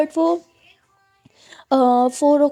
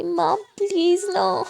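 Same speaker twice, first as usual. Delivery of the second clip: coaxing laugh; 6 semitones higher, much slower than usual. Acted.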